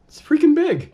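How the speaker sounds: high, loud burst of amazement; amazed outburst. Real, not acted. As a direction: blurt it out, stunned by the size. Unboxing the huge new iMac.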